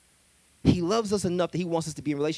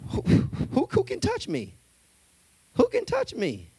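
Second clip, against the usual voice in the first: cocky; 10 semitones higher than usual. Acted. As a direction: dare anyone to touch you. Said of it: "mad cocky here".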